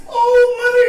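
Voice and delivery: loud, high cry of joy through tears; sobbing shout of wonder. Acted. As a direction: burst out weeping her name. Son sees mother's bowl.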